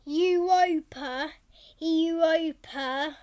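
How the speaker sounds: Europa drawn out slowly, syllable by syllable; slow careful name. Shown as a demonstration. Child practising the name Europa, said slowly.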